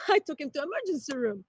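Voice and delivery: usual speaking voice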